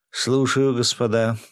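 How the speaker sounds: plain reading voice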